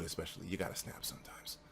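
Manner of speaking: soft unvoiced confiding; whispered aside. Real, not acted. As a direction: drop to a whisper for the point.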